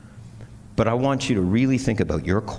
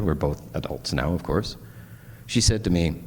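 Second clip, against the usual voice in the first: ordinary talk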